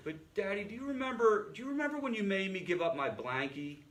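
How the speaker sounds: put-on high, imploring little-kid voice; five-year-old girl. Young daughter to father about her blankie, childlike. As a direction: imitate a small daughter pleading up at Daddy. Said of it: "his five-year-old daughter".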